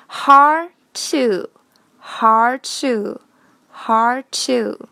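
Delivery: "hard to" with the d left unreleased; slow teacher demo of "hard to" as har-to; linked plosives. Shown as a demonstration. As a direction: say "hard to" slowly, dropping the d before t. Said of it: "前面那个爆破音咱们不要了".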